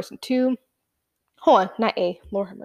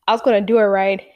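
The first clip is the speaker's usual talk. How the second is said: usual speaking voice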